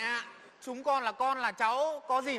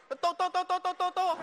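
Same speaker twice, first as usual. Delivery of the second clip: calling the dog; 6 semitones higher than usual. Retold or imitated. Son showing how he would call the family dog. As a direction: shout for the dog across the yard. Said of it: "Thế lúc bố gọi chó nhá".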